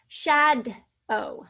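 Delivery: "Shadow" broken in two, shad, pause, oh; "Shadow" slowly, each syllable on its own; split into syllables. Shown as a demonstration. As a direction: sound out "Shadow" with a gap before the long O. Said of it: "Two syllables".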